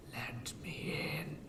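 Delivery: slow, menacing whispered demand; demanding creepy whisper. Acted. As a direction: hiss the demand slowly through the door. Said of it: "it demanded again".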